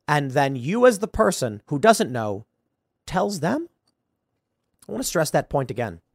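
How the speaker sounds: ordinary talk, nothing marked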